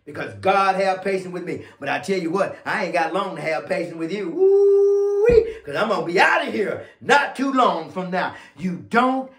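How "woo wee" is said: loud, high, sustained woo ending in a quick wee; drawn-out pulpit woo-wee; high preacher's whoop. Real, not acted. Preacher whoops between points.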